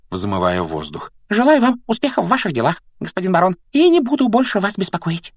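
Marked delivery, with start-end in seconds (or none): 1.3-5.3 high, squeaky servile farewell; obsequious poltergeist, pitched way up; frightened Peeves. A narrator's reading. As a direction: squeak politely like a scared imp. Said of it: "испуганно пробормотал Пивз".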